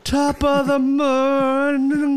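loud, high, drawn-out bad Irish; garbled mock Irish. Co-host mocks her on-air flub, loud garbled Irish. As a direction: stretch out a mangled Irish greeting. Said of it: "first on-air stroke in podcast history".

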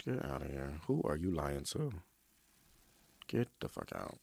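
low, soft, unimpressed mutter; low disbelieving brush-off; dismissive inner voice. Retold or imitated. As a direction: wave him off under your breath. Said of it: "I'm over here like".

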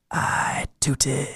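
a breathless secret whisper. Retold or imitated. Whispering a confession to his wife in public. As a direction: lean in and whisper it. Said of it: "I'll whisper in her ear".

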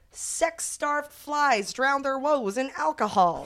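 slow, high newsreel headline; old-timey news voice. Shown as a demonstration. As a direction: read it like a 1940s newsreel. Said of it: "old-timey 1940s voice".

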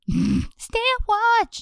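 squeaky high voice. Acted.

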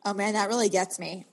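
ordinary talk

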